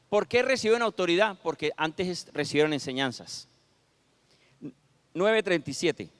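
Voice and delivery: normal talking voice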